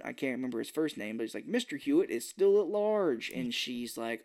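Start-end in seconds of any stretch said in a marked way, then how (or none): 1.5-3.3 higher, swooping newsreader cadence; TV news anchor. Acted. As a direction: announce it like breaking news.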